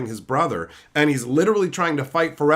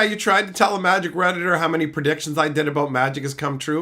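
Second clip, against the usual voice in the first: ordinary talk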